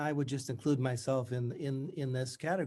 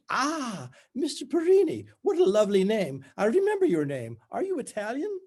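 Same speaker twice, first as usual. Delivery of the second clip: much higher, louder, strained bright Scottish schoolmistress; strangulated faux brightness; 10 semitones higher, 7 dB louder than usual. A narrator's reading. Tutor greets her old student, strangulated and falsely bright. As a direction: push it through gritted teeth with forced cheer. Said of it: "In her strangulated voice, pushing through gritted teeth with unbearable faux brightness".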